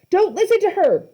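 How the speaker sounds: loud high shout. A narrator's reading. Annabeth warns Percy from the statues, shouting. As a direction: yell the warning.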